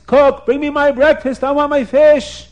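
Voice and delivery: high, loud royal call; hungry king ordering breakfast; demanding king. Acted. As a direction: holler impatiently for the cook. King calls for his breakfast.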